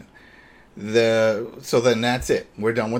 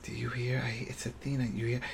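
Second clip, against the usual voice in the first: hushed; 11 dB quieter than usual. Retold or imitated. Acted hushed quote hearing baby.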